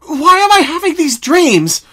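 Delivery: frantic high-pitched pony crying out in despair; anguished cry. Acted. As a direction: cry it out at the ceiling, begging for an answer. Pinkie tormented by nightmares.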